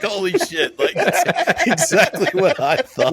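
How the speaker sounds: high, loud laughing exclamation; squealing laughter of shock; laughing disbelief. Retold or imitated. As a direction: laugh through it in amazement. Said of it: "And I was like".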